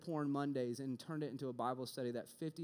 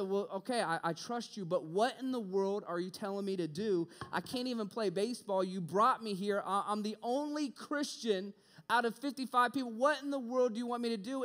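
louder, higher, pleading with God; exasperated plea to God; 7 semitones higher, 5 dB louder than usual. Retold or imitated. Frustrated student demanding answers from God, raised voice. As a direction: throw the question up at heaven.

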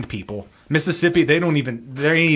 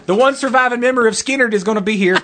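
higher, louder hammed-up announcer calling out; 9 semitones higher, 4 dB louder than usual. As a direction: call the crowd in like a bar-lot host. Hosting a mock pageant.

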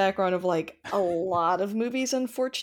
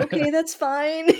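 mock forced acceptance; 8 semitones higher, 5 dB louder than usual. Mock reply to uncomfortable viewers.

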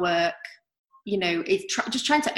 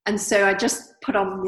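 ordinary talk

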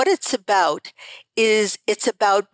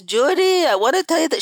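put-on an old Eastern European grandmother's accent, pitched higher; 7 semitones higher than usual. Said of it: "she had an accent".

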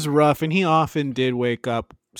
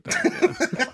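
mock high. Joking host.

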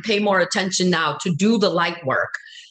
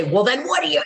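higher-pitched mock challenge; aggressive arguing; 7 semitones higher, faster than usual. Imitating friend's partner arguing about war, mildly aggressive. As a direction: imitate an argumentative man pushing back. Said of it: "he's very aggressive and arguing".

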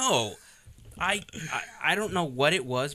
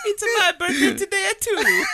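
very high, loud silly voice; hammed-up squeaky joining in; cartoon falsetto; 8 dB louder than usual. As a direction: squeal it like a game mascot. Joins in with the Mario voice.

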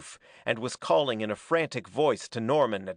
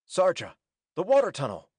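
raised, urgent call at moderate volume; sudden shout; 5 semitones higher, 3 dB louder, faster than usual. A narrator's reading. Norman directs Sarja to the tunnel, sudden shout. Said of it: "He shouted suddenly".

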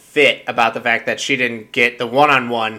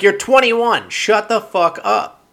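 much higher mock outburst; exasperated retort; 7 semitones higher than usual. Host scolding a contestant, higher exasperated retort. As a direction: scoff it at her, way up high. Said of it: "it's like".

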